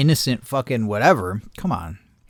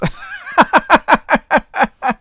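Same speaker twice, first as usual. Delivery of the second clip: long high cackle; high laugh; 13 semitones higher than usual. Real, not acted. His mother's curse coming true, high laughter.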